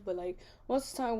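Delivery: usual speaking voice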